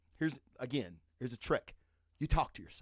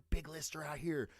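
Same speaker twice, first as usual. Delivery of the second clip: usual speaking voice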